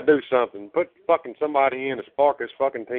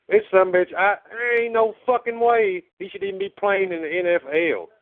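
passionate angry rant; 7 semitones higher than usual. Real, not acted. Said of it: "Love the passion".

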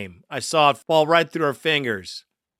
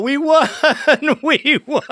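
high, louder yell broken by laughter; laughing mock yell; 10 semitones higher, 4 dB louder than usual. Host laughing as he echoes a caller's fake victory yell. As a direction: yell it through giggles like a fake victory cheer. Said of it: "Go out at the third quarter and yell".

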